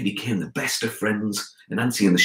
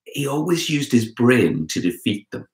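ordinary delivery, nothing marked

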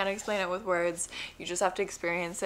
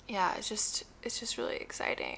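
whisper; 9 dB quieter than usual. Real, not acted. Soft emotional aside.

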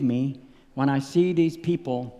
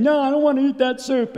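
put-on higher, whining little girl protest; imitated child's refusal; 9 semitones higher, 4 dB louder than usual. Father imitating his daughter refusing supper, high-pitched protest.

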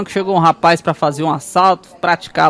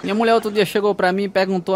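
normal talking voice

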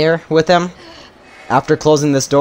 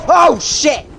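startled shout; 10 semitones higher, 4 dB louder than usual. Real, not acted. Sudden danger in game.

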